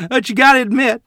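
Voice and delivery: high, loud, laughing; loud laughter. Acted. Boy remembering the dancing pigs, laughing loudly. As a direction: crack up as you say it. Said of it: "burst out into loud laughter".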